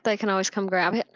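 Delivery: normal talking voice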